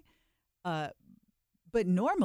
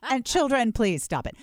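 loud, higher mock telling-off; hammed-up stern warning to bootleggers; mock scolding; 6 semitones higher, 9 dB louder than usual. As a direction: wag a finger at the kids, playfully.

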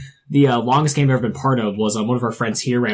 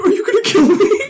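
laughing; 5 dB louder than usual. Retold or imitated. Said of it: "the player's like".

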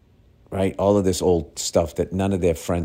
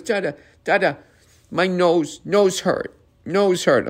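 put-on high-pitched little kid complaint; complaining toddler; 12 semitones higher, slower than usual. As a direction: imitate a toddler pleading for attention. Toddler's next excuse to stay up.

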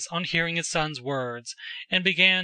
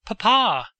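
raised, higher-pitched cry for father; calling after him; 5 semitones higher, 5 dB louder than usual. A narrator's reading. Son to his departing father, calling after him. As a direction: call out urgently, a touch of distress. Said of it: "he called after him, sobbing".

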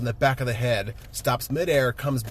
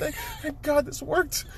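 very high, breaking near-tearful voice; choked-up relief; 13 semitones higher than usual. Retold or imitated. Retelling Stark's relief at the hug, choked-up voice. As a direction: stammer it out on the edge of tears. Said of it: "He's still crying again".

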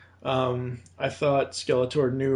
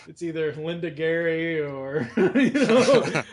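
laughing; 3 semitones higher, slower than usual. Real, not acted. Laughing at own joke.

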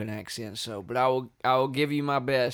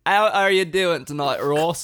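put-on British accent; 3 semitones higher, 6 dB louder than usual. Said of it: "You went in a different direction than I expected".

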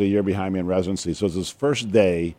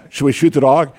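louder, higher mock-urgent question; 6 semitones higher, 8 dB louder than usual. Paramedic asks doctor over the radio, mock-urgent shout. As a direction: voice an urgent paramedic asking for orders.

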